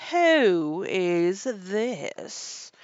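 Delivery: loud, long-drawn, imperious question; severe queen. A narrator's reading. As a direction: demand it severely, stretching every word. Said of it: "the Queen said quite severely".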